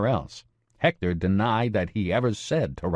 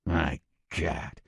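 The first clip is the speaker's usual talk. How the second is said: gruff voice; 3 semitones lower, 6 dB quieter than usual. Acted. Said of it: "the man with the gruff voice said".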